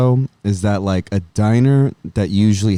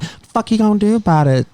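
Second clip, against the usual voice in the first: much higher, hammed-up fight taunt; mock tough Dulce; 11 semitones higher than usual. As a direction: play her squaring up, over the top. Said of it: "I couldn't imagine you being just like".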